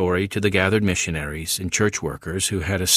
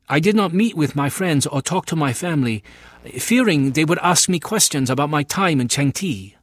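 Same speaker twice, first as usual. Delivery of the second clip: put-on higher, earnest voice of a young man; young convert Chang San; 8 semitones higher, 3 dB louder than usual. Young convert tells the missionary his story, earnest and higher. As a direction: tell the missionary your ordeal, pitched up and earnest.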